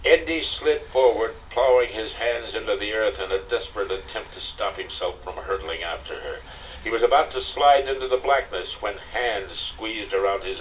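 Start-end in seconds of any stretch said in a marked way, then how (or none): none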